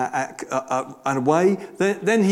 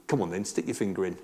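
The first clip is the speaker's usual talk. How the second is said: low, quiet, quick throwaway dare; cheeky; 8 semitones lower, 8 dB quieter, faster than usual. Retold or imitated. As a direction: toss it out softly and fast, cheekily. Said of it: "did he say that in a cheeky way".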